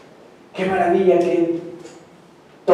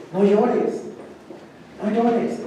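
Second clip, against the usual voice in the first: tender comfort; 5 semitones higher, 6 dB quieter, much slower than usual. Acted. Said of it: "Le dice mi Señor Jesucristo".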